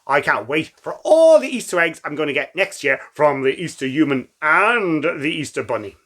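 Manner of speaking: ordinary delivery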